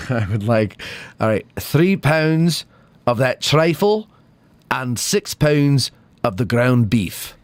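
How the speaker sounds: slow, careful Scottish accent. Shown as a demonstration. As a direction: feel your way through a Scottish accent. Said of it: "Go back to Scottish".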